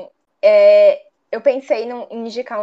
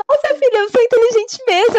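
high, loud mock-mother exclaiming; hammed-up proud mother, pitched way up; playing mom suddenly impressed with herself; mother amazed; 12 semitones higher, 5 dB louder than usual. Mother voiced in retold story.

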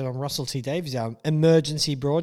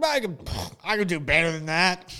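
higher, louder smug fanboy brag; mock fanboy boast; 5 semitones higher, 4 dB louder than usual. Fan boasting to the studio, mock smug brag. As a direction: puff up like a couch critic. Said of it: "fanboy couch warriors that are like".

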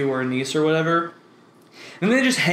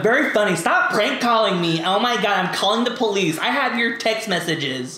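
higher-pitched mock threat; scolding aunt; 4 semitones higher than usual. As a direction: imitate an indignant aunt fed up with pranks. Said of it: "that's, like, what my aunts would say".

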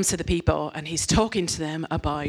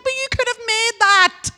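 much higher, louder disbelief; incredulous; 6 dB louder than usual. Retold or imitated. Preacher to idol worshippers, high incredulous retort. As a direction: point out the obvious in disbelief.

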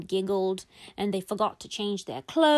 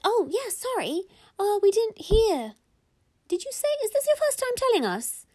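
put-on high-pitched kid voice; children's excuses; 12 semitones higher than usual. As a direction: apologise like a child caught out. Kids excusing themselves to mum.

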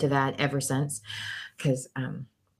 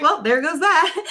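laughing resignation; 13 semitones higher than usual. Retold or imitated. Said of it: "I'm like".

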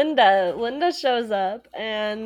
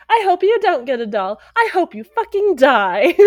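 higher, louder spiteful snarl; spiteful yell; 6 semitones higher, 8 dB louder than usual. Retold or imitated. Linda cursing the upset line, spiteful and loud. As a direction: spit the curse at the crowd. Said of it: "she's like".

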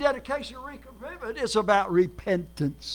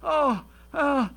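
higher, whiny mock complaint with a sob; mock whining; 4 semitones higher than usual. Preacher mocking people who moan about migraines, mock whine. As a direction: ham up a sufferer moaning about a migraine. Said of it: "And people would say".

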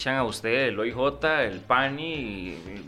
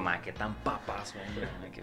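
hushed aside; 3 semitones lower, 9 dB quieter than usual. Retold or imitated. Reacting to child, aside.

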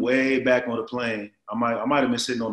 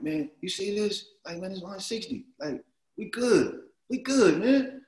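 high-pitched retold amazement, rising higher at the end; hushed excitement; 9 semitones higher than usual. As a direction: act out marvelling at the money, voice pitched up. Retold excitement over the contract money.

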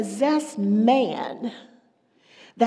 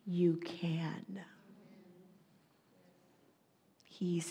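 intimate hushed whisper; 3 semitones lower, 10 dB quieter than usual. Acted. Preacher whispering reassurance to the congregation. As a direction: whisper it like a secret promise.

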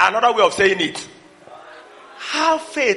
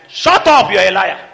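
rebuking inner voice; 9 dB louder than usual. Retold or imitated. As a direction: shut the doubt down hard. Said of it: "a voice is saying that".

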